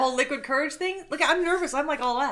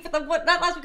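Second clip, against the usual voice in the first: ordinary talk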